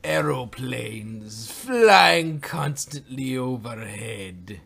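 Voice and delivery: slow, drawn-out mock-epic proclamation; grandiose boast. Answering talk of a plane, grandiose mock boast. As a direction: stretch every word grandly.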